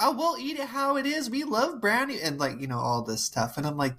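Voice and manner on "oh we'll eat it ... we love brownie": high, sweet mock old folks voice; lightly squeaky grandma impression; grandparents. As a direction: play doting grandparents, way up high.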